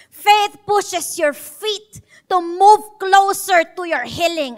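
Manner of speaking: slow, loud, emphatic sermon; slow preaching. Acted. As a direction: hammer each phrase slowly. (mabagal at mariing pangangaral)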